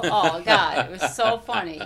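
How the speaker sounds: loud, helpless laugh through the words; real laughing. Real, not acted. Cracking up over an old cartoon, real laughing. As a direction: burst out laughing as you say it.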